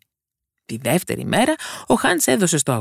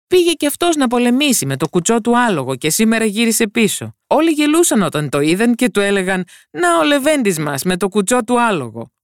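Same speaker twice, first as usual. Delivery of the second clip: amused gardener; 10 semitones higher, 4 dB louder than usual. A narrator's reading. Gardener mocks the lame horse.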